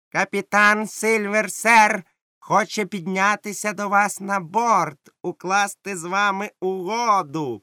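pirate shouting Silver's offer to the captain; pirate herald shouting. Acted. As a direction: announce like a rough herald. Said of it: "прокричав він".

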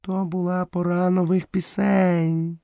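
high, slow, meek panther voice; very modest. A narrator's reading. Panther meekly explains the elephant's absence, very modest. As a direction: draw the words out humbly, pitched high. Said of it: "дуже скромно відповіла пантера".

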